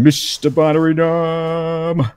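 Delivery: big, drawn-out announcer build; hammed-up introduction. Host introducing his co-host, hammed-up announcer introduction.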